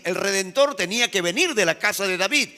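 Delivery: usual speaking voice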